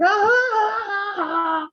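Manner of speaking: mock crowd gasp; high mock gasp. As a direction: shriek like onlookers.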